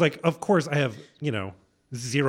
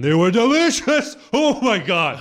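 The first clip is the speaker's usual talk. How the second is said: loud, high burst of real delight; ecstatic raving; 9 semitones higher, 8 dB louder, slower than usual. Real, not acted. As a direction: rave about food, voice leaping up and slowing. Raving about fried cheese curds.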